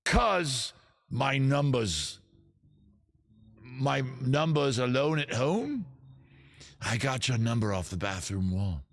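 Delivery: hammed-up recital, swelling and sinking; over-the-top British poet. Poet reading Bathroom Wall lyrics as verse, theatrical British poetry voice. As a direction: declaim rock lyrics like solemn verse. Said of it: "my poetry voice".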